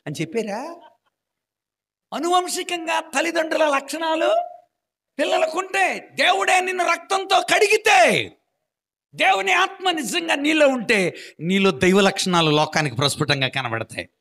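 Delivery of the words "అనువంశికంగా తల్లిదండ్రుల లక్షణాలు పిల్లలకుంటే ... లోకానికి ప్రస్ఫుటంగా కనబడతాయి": much higher and louder fervent preaching; shouted preaching. Acted. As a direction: shout the message out with full force. Preaching at full force. (ఉద్రేకంతో అరిచి బోధించడం)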